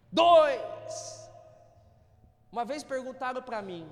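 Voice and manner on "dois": sudden loud punchline; mock answer. Pastor joking about the doctor, loud mock answer. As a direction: pop out the word as a joke. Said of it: "fala dezenove".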